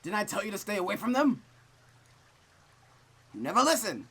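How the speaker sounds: high, loud scolding parent; scolding mother. Acted. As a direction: berate her for not listening.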